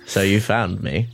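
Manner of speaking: Judi Dench. Acted. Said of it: "Dame Judi Dench".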